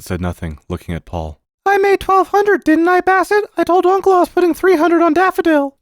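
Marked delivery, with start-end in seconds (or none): 1.6-5.8 put-on very high, loud child voice; eager little boy. Boy telling uncle about his winnings, high-pitched and eager. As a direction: pipe up as a keen small boy.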